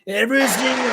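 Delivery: high, loud echo of the politician; mimicking Trump. Vlogger echoing Trump's clip to mock him, high mimicry.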